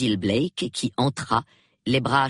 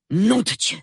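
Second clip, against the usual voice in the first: louder, higher blurted curse; Basil Blake blurts it out, shaken; blurted oath; 9 semitones higher, 5 dB louder than usual. A narrator's reading. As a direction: let the curse slip out. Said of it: "lâcha Basil Blake".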